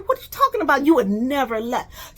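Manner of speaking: high, loud incredulous shout; loud outraged disbelief; angry disbelief. Retold or imitated. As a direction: hurl the question back in shock. Angry disbelief in retold quarrel.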